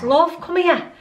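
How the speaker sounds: high, loud call across the garden; retold shout. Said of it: "and he shouts".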